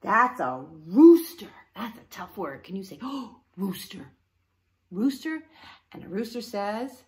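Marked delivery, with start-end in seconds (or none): none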